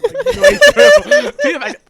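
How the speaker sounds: high, loud squeal of laughter; laughing. Real, not acted. Laughing at own glitch.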